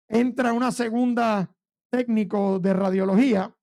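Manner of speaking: usual speaking voice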